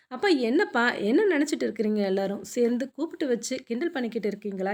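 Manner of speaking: usual speaking voice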